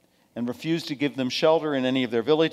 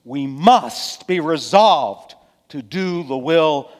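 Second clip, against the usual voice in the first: loud, high, emphatic sermon delivery; forceful preaching; 6 semitones higher, 10 dB louder than usual. Real, not acted. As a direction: drive the lesson home from the pulpit, slowly and loudly.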